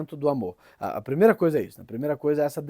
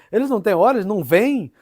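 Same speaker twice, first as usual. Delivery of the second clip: raised, louder incredulous retort; São João, choleric; 6 semitones higher, 7 dB louder than usual. Retold or imitated. Saint challenges the former pupils about the boys, choleric and exasperated. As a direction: imitate a hot-tempered man demanding the obvious. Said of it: "O São João era bem colérico".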